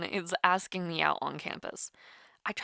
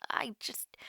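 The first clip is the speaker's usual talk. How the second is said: whisper; 8 dB quieter than usual. Acted. Whispering her worry to a sick friend on the call. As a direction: trail off into a whisper.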